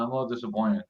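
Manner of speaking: normal talking voice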